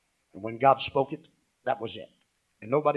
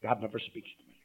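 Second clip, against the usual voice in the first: softer, lower repeat of the complaint; quiet quoted complaint; 6 semitones lower, 12 dB quieter than usual. Retold or imitated. Pastor repeating the doubter's words, much softer. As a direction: murmur it back to them. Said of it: "Don't ever let those words come out".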